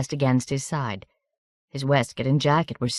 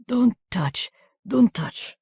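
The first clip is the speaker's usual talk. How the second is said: wounded man in pain; 8 semitones higher, slower than usual. A narrator's reading.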